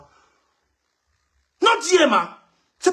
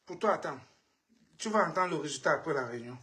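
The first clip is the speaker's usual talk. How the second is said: low, soft and fast menacing warning; cold quiet threat; 9 semitones lower, 9 dB quieter than usual. Real, not acted. Warning the man from London of his fate, cold quiet threat. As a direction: drop the voice and promise payback.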